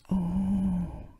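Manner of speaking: drawn-out, mildly excited oh; excited gasp. A narrator's reading. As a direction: breathe out an understated oh of wonder. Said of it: "gasped Peppa excitedly".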